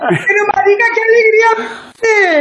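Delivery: slow, high, near-tearful delight; high tearful joy. Real, not acted. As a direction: cry out how happy you are. Overjoyed greeting.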